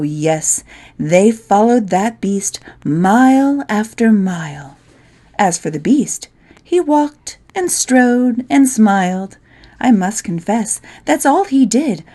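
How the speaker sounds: plain reading voice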